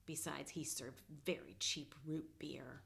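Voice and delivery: snide whispered afterthought; Snoopy adds a petty jab, whispering; whispered aside. Acted. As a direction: whisper it out of the side of your mouth.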